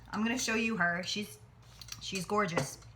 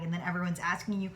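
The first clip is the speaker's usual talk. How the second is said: ordinary delivery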